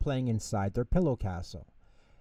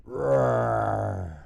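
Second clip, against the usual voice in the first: loud far-off bear roar; 5 dB louder than usual. Acted. Bear roaring from the next room, loud roar. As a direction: let out a big friendly roar.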